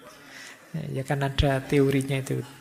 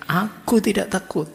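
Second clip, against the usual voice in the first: loud, high defiant yell; a frightened student's loud, high show of courage; retold shout; 5 semitones higher, 5 dB louder than usual. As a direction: yell it while kicking the door. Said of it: "sambil teriak".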